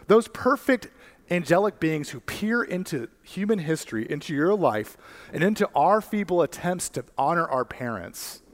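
high, ringing preacher's climax; preaching at full force. Acted. Preacher tells his congregation God brags about them, high and ringing. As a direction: lift the room, proclaim it.

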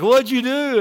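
high, loud mock blurt; eager stranger. Stranger asks pastor about his cast, mock high loud blurt. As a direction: fire the nosy question high and loud.

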